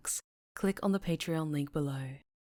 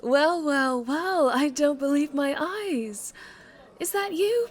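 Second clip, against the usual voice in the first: louder, higher, slow and teasing; delighted surprise; 10 semitones higher, 9 dB louder, slower than usual. Acted. Mob boss catching her old employee in the cafe, louder teasing surprise. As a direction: savor the surprise of finding him.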